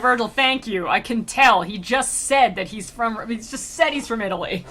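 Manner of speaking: sass your guide, half laughing; sassy. Retold or imitated. Dante tells Virgil he can tell already, sassy. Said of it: "he doesn't sass Virgil".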